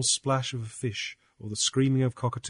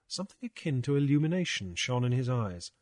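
plain reading voice

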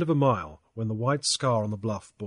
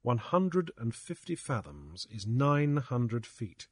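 plain reading voice

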